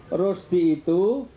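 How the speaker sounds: drawn-out "Rusdi itu" with a pause after itu; wrong phrasing, slow. Shown as a demonstration. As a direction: stretch "Rusdi itu" slowly, stopping on itu as if it ends there. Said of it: "kalau saya intonasinya salah".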